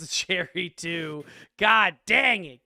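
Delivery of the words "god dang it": louder, slow exasperated groan of words; hammed-up exasperation; mock frustration. Real, not acted. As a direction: throw the phrase out in comic annoyance. Game master's mock frustration.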